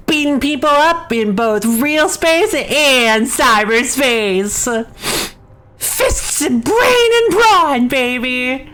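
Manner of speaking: slow, punched-out hype call climbing high; hyped battle boast. Acted. Gamer hyping their brawler choice, hammed-up boast. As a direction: draw it out like a hammy war cry. Hypes chosen game class.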